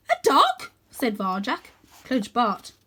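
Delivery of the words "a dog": very high, startled question; surprised. Acted. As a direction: ask in shock. Varjak surprised.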